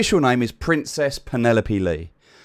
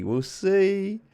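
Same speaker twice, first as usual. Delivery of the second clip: teasing sing-song; 9 semitones higher than usual. Shown as a demonstration. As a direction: jump higher for a playful we'll see. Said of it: "we'll see, we'll see".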